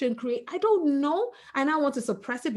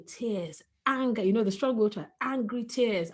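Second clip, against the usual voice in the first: normal talking voice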